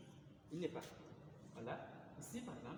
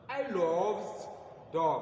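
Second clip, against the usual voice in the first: "I love dog" called out loud in English; example said loudly; 2 semitones higher, 6 dB louder, much slower than usual. Shown as a demonstration. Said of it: "si je dis par exemple". (exemple anglais lu fort)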